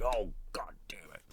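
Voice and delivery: whispered fed-up curse under the breath; muttered curse. Retold or imitated. Slasher reading the caller's text, whispered curse. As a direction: curse it quietly to yourself.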